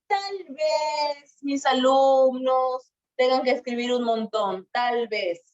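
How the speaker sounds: demonstrated wondering, drawn out; doubting. Shown as a demonstration. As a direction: stretch the words out as if unsure.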